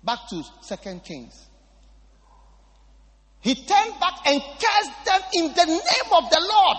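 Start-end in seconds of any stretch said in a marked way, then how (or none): 3.4-6.8 high loud proclaimed verse; thundering preached verse; preaching shout. Real, not acted. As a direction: proclaim it with full force. Preacher repeats the verse loudly.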